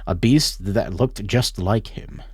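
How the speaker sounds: low, measured storybook narration; story narration. A narrator's reading. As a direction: read it like a quiet tragic tale. Narration of game text.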